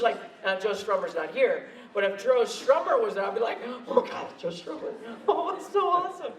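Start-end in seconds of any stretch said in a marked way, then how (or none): 3.9-6.3 hushed, giggly starstruck gush; giddy fan. Retold or imitated. Fan meeting his punk hero, giddy.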